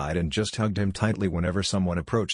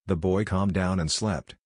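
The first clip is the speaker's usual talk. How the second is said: ordinary delivery, nothing marked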